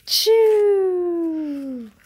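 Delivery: loud, high hammed-up sneeze burst; acted sneeze. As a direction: let the sneeze explode out. Gerald's first big sneeze.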